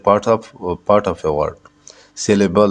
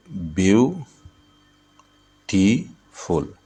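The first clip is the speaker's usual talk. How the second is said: "Beautiful" very slowly as view, टी, फुल; three beats in "beautiful" with long pauses between; syllable by syllable; much slower than usual. Shown as a demonstration. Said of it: "beautiful में तीन syllable है". ("Beautiful" को ब्यू... टी... फुल, रुक-रुक कर)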